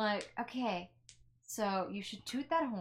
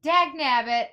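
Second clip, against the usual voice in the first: loud, higher cartoonish outburst; mock exasperation; 4 semitones higher, 12 dB louder, slower than usual. Sentry hears clanking and reacts, loud mock annoyance. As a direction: burst out in comic annoyance. Character startled awake by noise.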